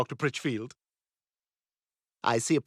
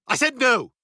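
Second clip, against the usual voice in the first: higher and louder, snapping it back; louder, higher annoyed repeat; irritated repeat; 8 semitones higher, 4 dB louder than usual. Acted. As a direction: bark the answer again, annoyed. Repeats answer to "Excuse me?.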